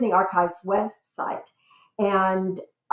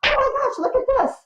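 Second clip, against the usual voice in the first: high, loud re-enacted squeal of discovery; excited squeal; 5 dB louder, faster than usual. Researcher re-enacting her find for the archives staff, excited squeal. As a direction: squeal it out like you've just struck treasure. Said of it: "squealing and going".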